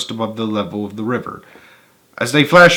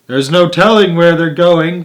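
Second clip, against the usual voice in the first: higher, louder, slow and delighted; gleeful cry; 6 semitones higher, 7 dB louder than usual. A narrator's reading. Wonka thrilling the passengers in the tunnel, gleeful cry. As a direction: relish the chaos, crying out. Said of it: "cried Mister Wonka, hooting with laughter".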